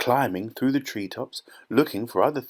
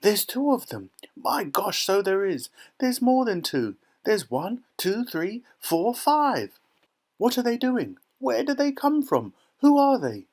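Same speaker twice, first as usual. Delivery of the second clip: high-pitched clamour of astonished voices; squealed, amazed outbursts; excited shouting; 11 semitones higher than usual. A narrator's reading. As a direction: fire off exclamations like a crowd. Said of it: "everybody started shouting at once".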